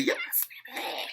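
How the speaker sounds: squealed, very high cheer; high squeaky cheering; excited cheer. Retold or imitated. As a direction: squeal your approval like a fan. Speaker re-enacts own cheering.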